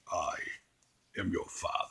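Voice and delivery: hushed, breathy dark-lord reveal; lightly voiced Vader, whispered; hushed villain quote. Lightly voiced villain revealing fatherhood, hushed. Quoting the famous Vader line.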